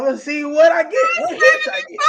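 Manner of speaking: excited shout. Real, not acted. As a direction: yell it out, thrilled at the idea.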